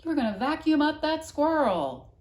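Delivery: loud high echo of the warning; shouting again. A narrator's reading. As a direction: hear the shout hang in the air. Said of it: "seeing them hanging there".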